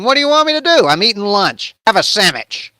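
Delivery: high, loud comic protest; exasperated defence. Acted. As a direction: burst out, then push the point loudly. David defends himself, exasperated.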